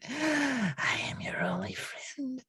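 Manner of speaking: creepy whisper. Acted. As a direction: whisper it possessively. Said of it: "too creepy for my taste".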